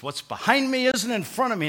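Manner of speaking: a high, lightly voiced quote of the driver, accent not attempted; Ferrari driver's line. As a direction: toss off the driver's line with swagger. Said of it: "And he's got this accent".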